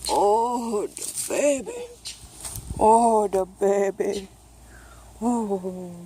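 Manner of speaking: tender. Real, not acted. Tender words to the baby.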